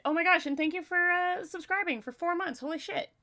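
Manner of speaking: high, fast burst of real delight; excited thanks. Real, not acted. Streamer thanking a subscriber for four months, excitedly surprised. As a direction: gush with surprise at the gift.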